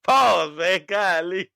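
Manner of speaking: high laughing protest. Real, not acted. Laughing at friend's joke.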